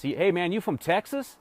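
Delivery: a relaxed Southern Texan greeting; Texas accent. Shown as a demonstration. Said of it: "they might talk like this, like they're from Texas".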